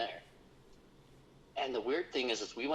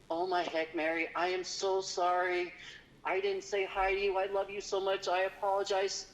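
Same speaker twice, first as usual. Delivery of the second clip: high, desperate begging for forgiveness; pleading apology; 10 semitones higher than usual. Retold or imitated. Guide on his knees apologizing to Mary, high and pleading. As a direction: plead with her like a prayer, voice up high. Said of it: "like he was gonna pray".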